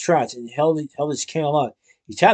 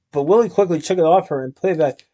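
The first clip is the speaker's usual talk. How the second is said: usual speaking voice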